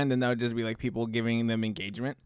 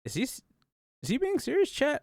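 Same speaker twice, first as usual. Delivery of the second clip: much higher, squeaky disbelief; incredulous; 12 semitones higher than usual. Real, not acted. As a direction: ask it in stunned disbelief. Disbelief at video's claim.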